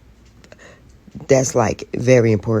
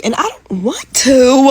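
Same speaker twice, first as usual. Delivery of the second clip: higher, louder, slow whining cry; meltdown whine; 7 semitones higher, 7 dB louder, slower than usual. Real, not acted. Woman having a morning meltdown before work, whining. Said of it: "having a little meltdown".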